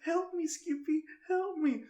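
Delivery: high, pleading call to Skippy, lightly hammed; mock cry for help. Telling a friend about a Skippy episode, mock pleading kid. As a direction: play a kid begging Skippy to save him. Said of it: "he goes".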